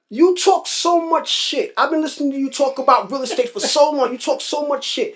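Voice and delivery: high-pitched imitation of a Grenadian old-timer; Grenadian accent. Old-timer telling off a co-worker who only talks, Grenadian accent. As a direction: scold in a lightly put-on Grenadian accent. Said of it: "in his Grenadian accent".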